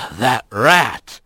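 low, groggy cat growl; sigh, then grumble it low; deep, woozy grumble; dazed bobcat. A narrator's reading. Dazed Bobcat recovers from crash.

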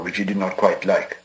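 usual speaking voice

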